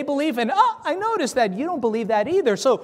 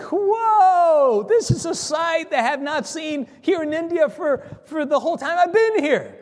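amazed reaction; 5 semitones higher than usual. Retold or imitated. Missionary spots a man with a Bible on the beach, amazed. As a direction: exclaim in astonishment at the sight.

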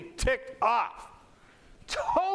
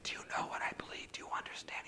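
quick, insistent whisper; fully voiceless, hushed questioning; 15 dB quieter, faster than usual. Retold or imitated. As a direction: whisper it to draw them in. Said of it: "I would like him to whisper to me".

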